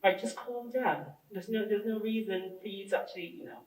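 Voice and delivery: soft, hushed, mock-calm voice. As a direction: say it very calmly and quietly. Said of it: "I said it very calmly, just like this".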